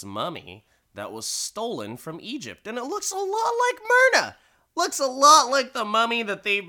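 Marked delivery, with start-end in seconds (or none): 2.7-6.7 very high, loud squeal; mock shocked revelation. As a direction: squeak out the reveal in exaggerated shock.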